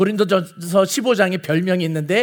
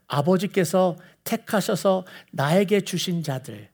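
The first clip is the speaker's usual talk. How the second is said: hushed prayer; 2 semitones lower, 6 dB quieter, slower than usual. Retold or imitated. Jesus praying to God, acted.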